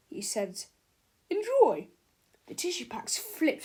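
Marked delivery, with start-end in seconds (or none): 1.3-1.9 high, loud sing-song; mocking salesman. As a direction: toss it off cheekily. Snoddy tossing tissues.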